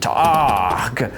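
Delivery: slow, put-on warrior voice; halting, mock-heroic god addressing his boy; ponderous tough guy, stumped and growling; Kratos. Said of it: "He's like".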